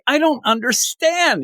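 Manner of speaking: slow confused voice. Retold or imitated. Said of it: "He's like".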